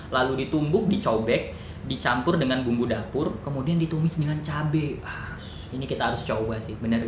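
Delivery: ordinary talk, nothing marked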